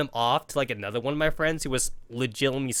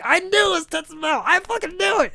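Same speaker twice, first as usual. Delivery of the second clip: very high, loud mock crowd; crowd exclaims; 13 semitones higher, 4 dB louder than usual. Graduation crowd learning who wrote it, high excited shout.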